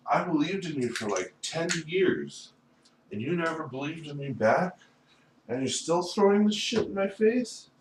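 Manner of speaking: high-pitched son accusing his father; acted reproach.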